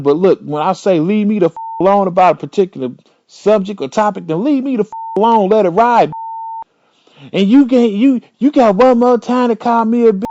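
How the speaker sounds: mock rage at girlfriend; building rant. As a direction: escalate into a threat.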